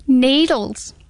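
usual speaking voice

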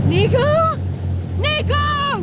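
high, frantic calls to the water; calling out. Acted. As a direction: call out in panic.

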